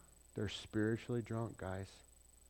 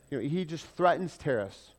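normal talking voice

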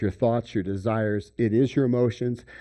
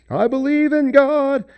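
much higher, loud, sing-song piety; mock pious claim; 13 semitones higher, 7 dB louder than usual. Worshipper putting on outward faith. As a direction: proclaim belief a bit too brightly.

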